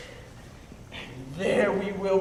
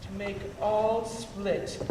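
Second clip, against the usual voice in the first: usual speaking voice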